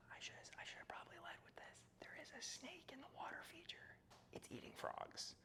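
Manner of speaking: hushed whisper so the snake won't hear; real whisper on spotting a snake. Real, not acted. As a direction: whisper it like on safari.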